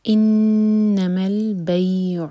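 stretched البیع, عین from the throat; tajweed recitation. Shown as a demonstration. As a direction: chant انما البیع, ghunna on نون. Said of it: "نون پر غنہ".

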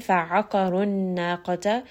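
fa'aqarun naqata slowly recited, ain from the middle of the throat; slow recitation. Shown as a demonstration. Tajweed teacher modelling throat letters, slow and careful. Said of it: "Ain is going to be from the middle of the throat".

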